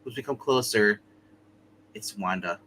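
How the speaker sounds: ordinary talk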